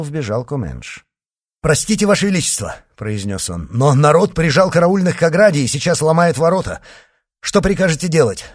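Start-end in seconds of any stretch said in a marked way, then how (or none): 1.6-2.8 fast, high, loud urgent report; breaking in with urgent news. A narrator's reading. Officer bursts in with urgent news. 3.7-8.5 fast, loud, raised-pitch report; officer hurriedly asking for orders; urgent report. A narrator's reading. Officer bursts in with urgent news.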